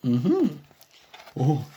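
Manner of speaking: usual speaking voice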